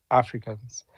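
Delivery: usual speaking voice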